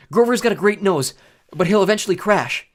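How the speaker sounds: high, loud pressing explanation; urgent warning. A narrator's reading. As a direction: press him to act before it's too late. Urgent warning mid-battle.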